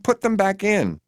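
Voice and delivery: high-pitched plea; begging. A narrator's reading. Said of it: "I beg".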